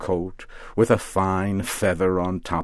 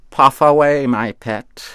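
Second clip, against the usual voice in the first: put-on louder, higher, delighted old witch; happy witch; 7 semitones higher, 7 dB louder than usual. A narrator's reading. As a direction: cheer him on with glee. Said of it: "Mother Rigby said with happiness".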